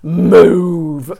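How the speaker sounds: a lightly done demonic command, one loud word; devil voice. Retold or imitated. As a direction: growl out a single menacing order. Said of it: "but I think the devil voice".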